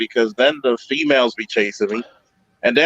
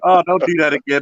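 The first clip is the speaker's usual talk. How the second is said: ordinary talk, nothing marked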